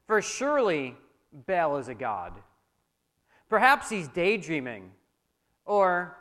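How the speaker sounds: mock-serious taunt, measured pauses; scoffing. A narrator's reading. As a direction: needle them with pretend reasoning. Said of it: "he scoffed".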